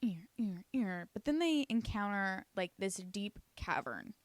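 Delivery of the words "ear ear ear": whispered fading echo. Pretend. Echoing into the tunnel, whispered faintly.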